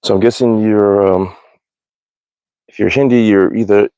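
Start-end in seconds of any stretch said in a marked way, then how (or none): none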